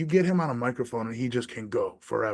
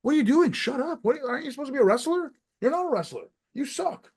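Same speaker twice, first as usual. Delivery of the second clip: high, heated mock yelling at bad wrestlers; mocking a bad wrestler, voice cranked high; mock rant; 13 semitones higher than usual. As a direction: scold them like a disgusted fan, pitched up.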